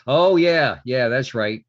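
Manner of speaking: loud, high oh yeah; sudden realization. Real, not acted. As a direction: remember it all at once. Sudden recollection.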